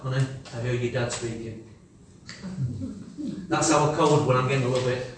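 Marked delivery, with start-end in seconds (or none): 0.0-1.6 calm loving wife reminding; gentle, soft reminder. Retold or imitated. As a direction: softly, lower and quieter. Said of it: "that arm just reaches out".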